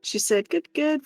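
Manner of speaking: ordinary talk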